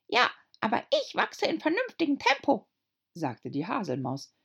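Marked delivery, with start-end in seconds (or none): 0.1-2.7 very high squeaky whisper; tiny squeaky complaint; whispering dormouse. A narrator's reading.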